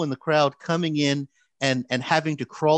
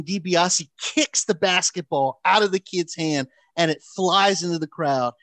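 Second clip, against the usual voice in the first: usual speaking voice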